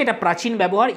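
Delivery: ordinary delivery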